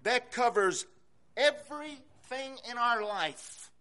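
normal talking voice

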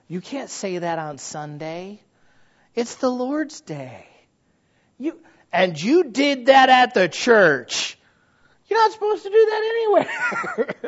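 high, slow scolding church lady voice; mocking churchgoer. Preacher mimics a scolding churchgoer, high and slow. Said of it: "people used to say this".

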